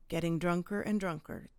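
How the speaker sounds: usual speaking voice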